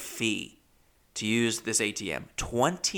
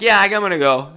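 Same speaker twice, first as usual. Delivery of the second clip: loud, higher flustered answer; upset outburst; 8 semitones higher, 10 dB louder, faster than usual. Retold or imitated. Comic replays brushing off worried friends, loud upset voice. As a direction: call it out, desperate to leave.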